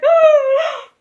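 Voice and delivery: very high, loud drawn-out squeal; mock fangirl gasp. Girls imagined seeing the full outfit, mock squeal. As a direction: imitate overwhelmed girls squealing. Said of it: "They would have been like".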